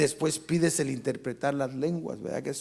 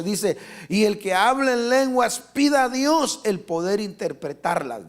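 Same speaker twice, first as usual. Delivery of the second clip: loud, high, fervent pulpit preaching; preaching at full force; 5 semitones higher, 6 dB louder than usual. Acted. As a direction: rouse the congregation, pushing hard.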